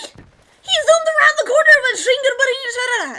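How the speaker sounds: high, loud imitated flapping noise; a playful sound effect, laughing through it; flappy window sound effect. Shown as a demonstration. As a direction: mimic a window rattling in the wind. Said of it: "my sound effects are, like, on point today".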